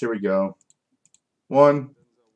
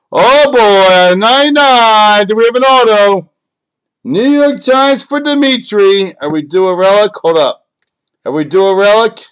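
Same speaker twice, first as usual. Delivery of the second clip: excited reveal; 6 semitones higher, 7 dB louder than usual. Real, not acted. Card breaker reveals a numbered card for Dimitri, excited shouting. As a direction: build the suspense at full volume.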